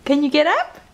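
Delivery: ordinary talk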